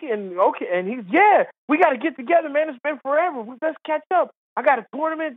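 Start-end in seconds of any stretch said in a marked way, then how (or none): 1.1-5.3 high-pitched, chummy enthusiasm; eager catching up. Retold or imitated. Golfer mistaking him for an old friend, eagerly chummy. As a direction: push to reconnect, voice pitched up.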